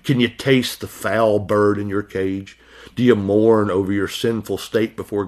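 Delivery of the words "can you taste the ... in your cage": ordinary delivery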